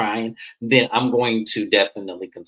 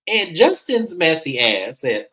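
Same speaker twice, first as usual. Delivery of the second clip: usual speaking voice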